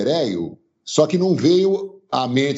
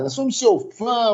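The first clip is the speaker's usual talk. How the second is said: gushing fan; 10 semitones higher than usual. Retold or imitated. Fan repeating praise.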